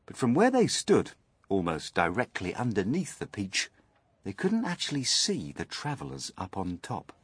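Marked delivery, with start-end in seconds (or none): none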